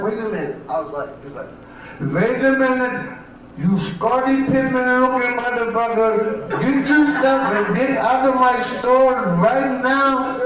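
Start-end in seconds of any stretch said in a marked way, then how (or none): 2.0-10.4 put-on high, drawn-out Indian-accented scolding. Indian store owner throwing out a customer, Indian accent. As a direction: order him out slowly in an Indian accent. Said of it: "one of the people in the Indian store".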